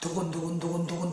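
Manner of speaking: soft low thumping heartbeat mimic; muffled imitated heartbeat; heartbeat sound. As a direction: whisper the pounding heart. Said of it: "심장이 막 뛰는 거".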